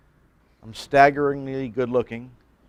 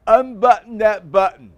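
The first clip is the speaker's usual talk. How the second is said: higher, louder insistent command, imitated; mimicking classmate; 8 semitones higher, 7 dB louder than usual. Classmate orders the pastor to unbutton his shirt. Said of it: "I'm gonna mimic him".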